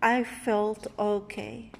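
ordinary delivery

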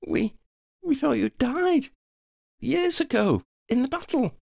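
high-pitched, halting disbelief; stammering. A narrator's reading. Hermione meets the friend she thought dead, stammering. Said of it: "Hermione stammered".

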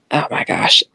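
under her breath, amused; whispered aside. Real, not acted.

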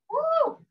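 high joyful woo; excited whoop. Real, not acted. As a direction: cheer it out high. Instructor cheering mid-workout.

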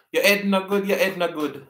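Jamaican patois, H dropped on "Ed". Shown as a demonstration. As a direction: say "Your Ed no good" in Jamaican patois, no H. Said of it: "We don't pronounce our H's in Jamaica".

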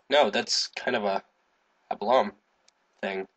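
usual speaking voice

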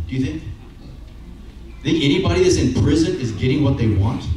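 normal talking voice

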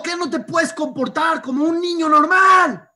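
grito agudo de madre exasperada; mamá gritando. Retold or imitated. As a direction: imita a la mamá que grita. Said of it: "le gritó, la mamá le gritó". (grito agudo de mamá exasperada)

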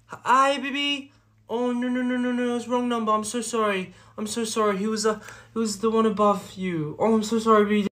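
high, cutesy apologetic voice; sweet baby talk. Acted. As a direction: switch to gushing apology for your sweetheart. Sweet apology to partner on phone.

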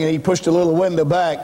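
normal talking voice

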